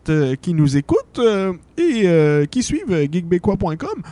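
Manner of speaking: lilting, lightly put-on Santa; jovial invitation with swooping pitch and hesitations; Santa Claus as host. Santa introduces his segment.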